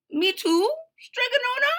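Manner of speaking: very high, eager begging; pleading squeak. Acted. Eager pupil begs her teacher, squeaky and pleading. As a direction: ask hopefully in a tiny high voice.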